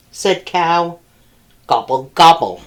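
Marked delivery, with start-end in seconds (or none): 1.7-2.6 low, grumpy turkey gobble; grumbling gobble. A narrator's reading. Turkey sulking after Cow sees through him, low grumbled gobble. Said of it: "grumbled Turkey".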